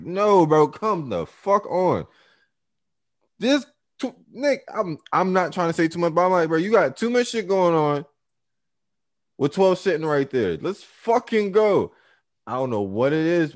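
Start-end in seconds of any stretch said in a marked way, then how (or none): 0.0-2.1 high loud yell, dragged out slowly; angry yell. Retold or imitated. Screaming at his friend to leave the fight, angry yell. 3.4-11.9 urgent yelling. Retold or imitated. Still yelling at friend outside club.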